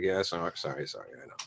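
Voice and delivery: low, hushed mumbled apology; sheepish apology. Acted. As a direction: imitate backing down, tailing away quietly. Imitating his apology to sound man.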